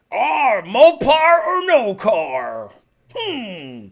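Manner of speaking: slow, stretched Texas drawl; Texan boss. Retold or imitated. Boss talking up his Jeep, slow Texan drawl. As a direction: drag it out like a Texan car braggart. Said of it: "[name] just summed up most of Texas".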